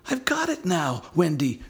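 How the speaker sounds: put-on high, excited boy's shout; thrilled child voice; John crying out. A narrator's reading. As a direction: cry it out in triumph. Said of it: "cried John".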